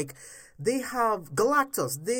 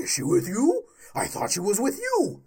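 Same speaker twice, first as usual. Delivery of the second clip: higher, louder mock Batman; sarcastic Batman line; mocking quote; 4 semitones higher, 3 dB louder than usual. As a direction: parody the joke line. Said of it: "Let's throw in a joke".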